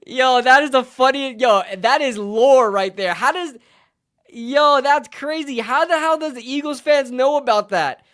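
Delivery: loud, delighted amazement; excited disbelief. Real, not acted. Streamer amazed Eagles fans know the joke, excited disbelief. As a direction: burst out, thrilled and incredulous.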